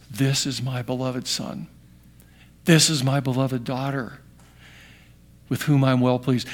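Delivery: low, soft, unhurried declaration of love; hushed, reverent tenderness; tender fatherly affirmation. Acted. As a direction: speak softly and deeply, as a loving father. Voice from heaven to beloved child.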